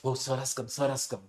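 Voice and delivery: lightly mocking, awed whisper-like hush; fawning over a politician. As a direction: imitate people flustered that sir has come. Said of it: "we are like".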